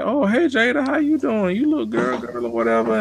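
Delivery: high, sugary mock greeting; imitating a chirpy, naive receptionist; naive sweet voice. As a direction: play the naive, overly friendly new girl, pitched high. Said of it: "She was like".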